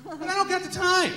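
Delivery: high-pitched mock whine; hammed-up protest about being rushed; mock complaint. Acted lazy guy in shower.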